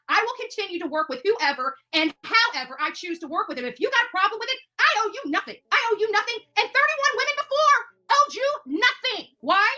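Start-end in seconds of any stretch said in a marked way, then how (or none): none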